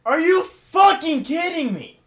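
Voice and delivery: high, slow, screamed disbelief; furious shout. Real, not acted. Raging gamer at failed jump.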